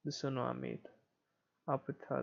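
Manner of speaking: normal talking voice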